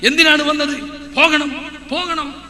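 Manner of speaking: hammed-up exorcism commands; mock exorcist. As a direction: imitate a jinn healer ordering it out in short bursts. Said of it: "മൂപ്പരിങ്ങനെ പറയാ".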